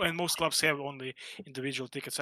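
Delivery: ordinary talk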